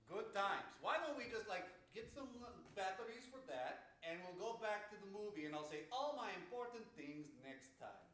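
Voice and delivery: loud and rowdy, shouting along; rowdy party animal. Real, not acted. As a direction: yell it over the noise. Shouting over a dead mic.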